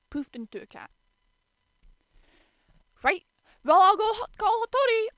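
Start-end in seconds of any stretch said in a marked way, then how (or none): 3.0-5.1 high, loud flustered rush; hasty exit. A narrator's reading. Shigure escaping before the fight, hasty flustered. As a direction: bolt out talking.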